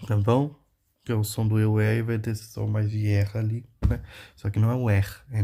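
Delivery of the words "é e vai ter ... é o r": usual speaking voice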